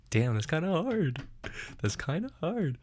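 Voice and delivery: high, swooping exclamation; amused self-hype. Real, not acted. Host admiring his own improvised line, excited.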